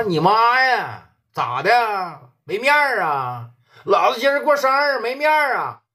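angry yelling. Retold or imitated. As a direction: bellow like a boss insulted on his birthday. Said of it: "老P当时就急了". (老P生日没面子，气得大喊)

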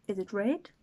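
usual speaking voice

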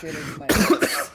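a slow, loud groan of dismay; loud slow outburst. Real, not acted. Gamer groaning loudly to his friends after being wrong. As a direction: drag it out loud, caught off guard. Ghost hunt just ended.

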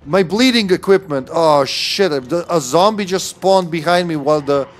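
loud, high shock at an ambush; alarmed outburst. Real, not acted. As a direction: cry out, caught off guard by a zombie. Zombie suddenly attacks during game.

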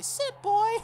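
high, loud mock order; mock commanding girl. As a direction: bark it like a haughty girl. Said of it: "Is she gonna say".

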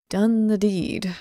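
ordinary talk, nothing marked

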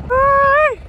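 high loud whoop of joy; excited whoop. Real, not acted. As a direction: whoop it out at the top of your voice. Car bouncing, cheering.

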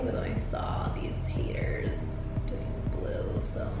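hushed gossipy aside; whispering. Real, not acted. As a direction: whisper it like a secret.

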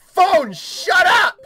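high, loud, drawn-out shout; exasperated yell. Real, not acted. Player yelling at distracting phone, exasperated. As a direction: yell down the interruption.

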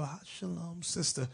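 very soft, low mild-mannered greeting; soft-spoken brother. Acted. Mild brother greeting a sister, soft and timid. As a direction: play him meek and barely audible. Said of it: "You talk like this".

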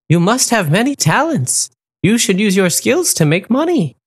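high, encouraging flamingo voice; flamingo Dahlia. A narrator's reading. Dahlia encouraging the wolf, high and kind. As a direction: lift the voice like a kind bird.

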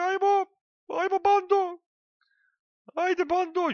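rally your gang like a tiny cartoon general; squeaky cheerleader voice. Gamer squeaking at his card troops to charge.

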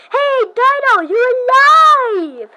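high, loud, very slow joyful cry; relieved friend shouting with joy; overjoyed. Acted. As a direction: stretch it out in delight. Overjoyed to see Dino.

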